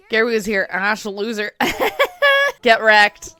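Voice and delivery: loud, gloating schoolboy jeer; mock rival taunt. Reading the rival's sign aloud as a loud gloating jeer. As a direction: crow it like a bratty rival.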